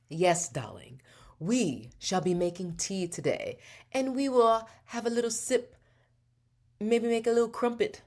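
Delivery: elegant mock-British narration, pitch climbing high; silly British accent. Shown as a demonstration. Host demonstrating narrating tea-making, silly elegant British accent. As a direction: narrate like a grand British hostess. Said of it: "in a silly or elegant voice. British accent".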